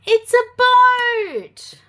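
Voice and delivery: high, slow, loud delight; excited reveal. Acted. Reading to a toddler, a slow thrilled reveal. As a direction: announce the boat with wonder.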